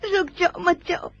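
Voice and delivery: tearful plea. Acted. Sister begs departing Owais not to go, tearful plea. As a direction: voice catching on a sob as she begs.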